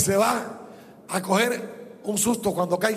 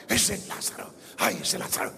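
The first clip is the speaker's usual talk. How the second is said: susurro asombrado; 12 semitones lower, 8 dB quieter than usual. Acted. Rich man sees Lazarus.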